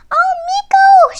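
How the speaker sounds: very high, loud happy squeak; a delighted breathless sigh; happily. A narrator's reading. Mouse answering Miko, very high and happy. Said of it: "she said happily".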